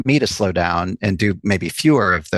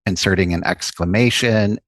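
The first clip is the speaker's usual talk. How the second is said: ordinary talk, nothing marked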